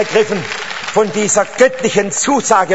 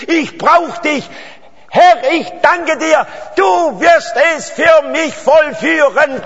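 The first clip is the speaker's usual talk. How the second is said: full-force preaching; 4 semitones higher than usual. Real, not acted. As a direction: shout the promise to the hall like a revival preacher. Preaching healing and a prayer.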